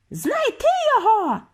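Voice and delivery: very high screeched order; head witch's high shriek; screeching. A narrator's reading. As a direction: screech the command to the hall. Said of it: "заверещала верховна відьма".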